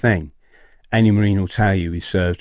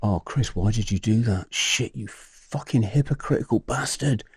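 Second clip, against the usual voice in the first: softly voiced inner scolding; inner self-reproach; 2 semitones higher, 6 dB quieter than usual. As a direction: quietly berate yourself, as if in your head. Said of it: "suddenly my heart's going".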